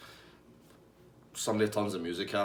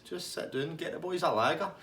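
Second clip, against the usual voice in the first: chilled out; 7 semitones higher than usual. Retold or imitated. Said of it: "he's totally fucking chilled out".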